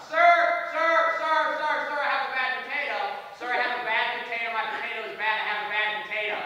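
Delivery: loud, high, whiny yell for the waiter; impatient customer shouting across a restaurant. Retold or imitated. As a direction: bellow across the room about a bad potato. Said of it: "yells across the restaurant".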